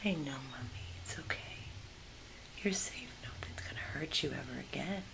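soft hushed reassurance; tender whisper. Real, not acted. Rescuer whispering comfort to the mother dog. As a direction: promise her gently in a whisper.

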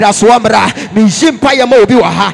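loud, driving Pentecostal sermon; fervent preacher rousing the congregation; full-force preaching. Real, not acted. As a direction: preach it hard in short, punchy phrases.

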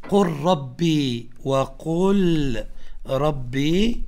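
"قل ربي" with the lam swallowed into a doubled r, qur-rabbi; "قل ربي" drawn out, lam assimilated into ra; lam merged into ra. Shown as a demonstration. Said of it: "فلذلك تقول".

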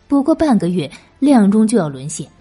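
ordinary delivery

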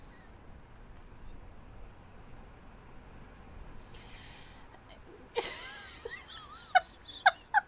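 silent wheezing laugh that goes on and on; can't stop laughing, almost soundless; long breathy laughing fit. Real, not acted. Laughing at the bile mix-up.

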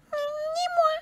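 tiny squeak, extremely high; mouse voice. A narrator's reading. Mouse refusing to help the hen, very high squeaky voice. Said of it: "dit la souris".

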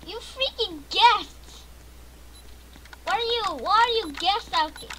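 high, loud gamer outburst; loud, high-pitched indignant shouting; exasperated yelling. Real, not acted. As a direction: yell at the intruders in frustration. Yelling at guest players.

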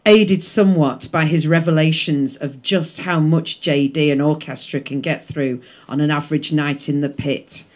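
book reading voice; reading aloud. Acted. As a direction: read the passage with a storyteller's lilt. Reads his book aloud.

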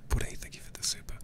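fast, hushed whispered thanks; quick whisper. Real, not acted. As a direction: rush a soft whisper.

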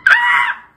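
very high excited shriek; high-pitched happy scream; excited squeal. Real, not acted. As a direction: squeal with glee. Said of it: "I'm excited".

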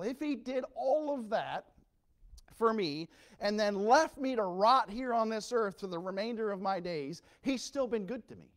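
loud, high, rising preacher cry; preaching at full force. Acted. Preacher crying out to the church, loud and high. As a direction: hammer the point home to the congregation.

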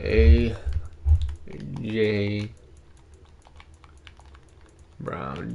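A, J, Brown each set apart by pauses; fading, spaced-out name. Real, not acted. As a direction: spell the name out word by word. Spacing out the name.

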